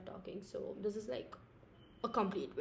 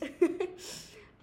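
breathy laugh; 9 semitones higher, 6 dB louder than usual. Real, not acted. Laughs at own joke.

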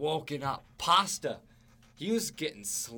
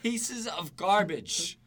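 mock disgust; 8 semitones higher, 6 dB louder, much slower than usual. Hit list insult at fans.

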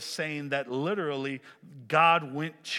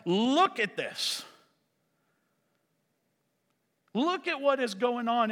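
high, exasperated demand for attention; calling out; 7 semitones higher than usual. Acted. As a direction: call out to God, stretching the opening words. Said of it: "He's calling out to God".

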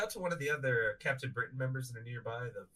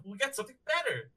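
ordinary talk, nothing marked

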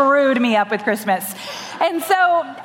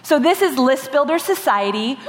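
normal talking voice

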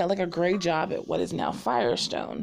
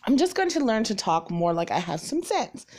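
ordinary talk

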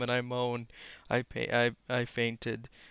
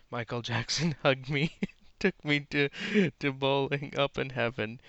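reading through real laughter, louder; cracking up; 3 semitones higher, 6 dB louder than usual. Real, not acted. Reader reaching Michael Jackson in heaven, cracking up. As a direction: keep reading while the giggles break in.

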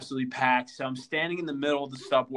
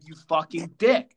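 mock angry insult; 2 semitones higher, 8 dB louder than usual. Woman insulting him.

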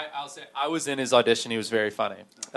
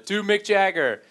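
mild mock yell, higher pitched; retold heckler's shout; 7 semitones higher than usual. Heckler demanding an impression from the comic, mock shout. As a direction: imitate a guy calling out a request. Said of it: "this guy screams out".

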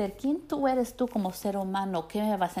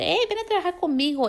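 eager company pitch; 10 semitones higher, 5 dB louder, faster than usual. Retold or imitated. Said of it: "hay compañías que van a decirte".

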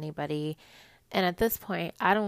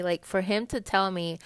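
ordinary talk, nothing marked

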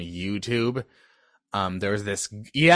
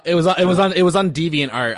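louder, clearer repeat; 6 semitones higher, 7 dB louder, faster than usual. Real, not acted. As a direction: say it again louder so it gets through. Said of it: "I'll just keep trying to repeat".

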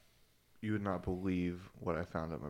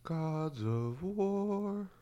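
very slow, higher ritual chant; solemn incantation; 9 semitones higher, much slower than usual. Acted. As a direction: intone it like a sacred oath. Movie quote in solemn voice.

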